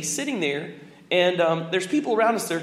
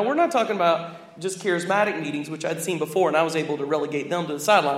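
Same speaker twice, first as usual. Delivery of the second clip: normal talking voice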